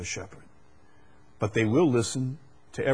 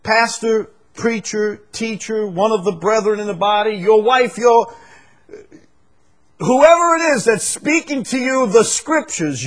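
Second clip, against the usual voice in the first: high, loud pulpit cry; fiery preaching; 11 semitones higher, 10 dB louder than usual. Acted. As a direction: call it out to the whole church. Preaching at full force.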